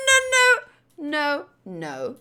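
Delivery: usual speaking voice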